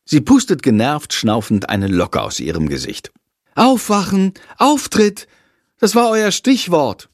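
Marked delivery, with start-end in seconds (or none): 3.5-7.1 put-on girl irritably calling out the missed cue; annoyed wake-up call. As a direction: snap them awake, they missed the cue.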